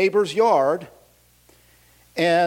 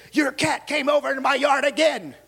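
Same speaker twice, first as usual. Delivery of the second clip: higher, shouting neighbor; angry neighbor shout; 7 semitones higher than usual. Retold or imitated. Neighbor complaining at the door, mock shout. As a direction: yell it at the door. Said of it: "she goes".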